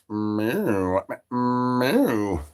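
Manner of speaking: soft mocking meow; cat meow. Streamer answering with a soft cat meow.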